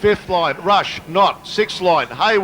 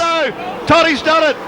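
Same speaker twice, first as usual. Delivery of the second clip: higher, louder shocked call; excited; 9 semitones higher, 3 dB louder than usual. Real, not acted. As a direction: cry out at the crash. Crash called live.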